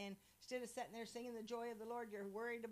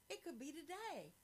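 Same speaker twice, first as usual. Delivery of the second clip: higher-pitched, wondering realization; hushed hopeful anticipation; 6 semitones higher than usual. Acted. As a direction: say it as a hopeful discovery. Acting hopeful expectation of return.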